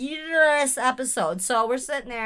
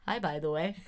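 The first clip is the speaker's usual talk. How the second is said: soft mimic of co-host's hi; imitating co-host; 5 semitones lower, 7 dB quieter than usual. Host mimics her co-host's quiet greeting, soft imitation. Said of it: "Did I sound just like you?".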